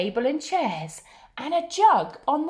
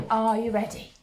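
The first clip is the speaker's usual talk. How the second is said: usual speaking voice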